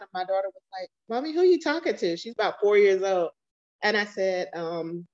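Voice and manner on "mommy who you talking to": high, lightly voiced small child asking; little girl voice. Mother imitating her small daughter asking who she talks to, in a high child voice. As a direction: pitch way up as a curious four-year-old.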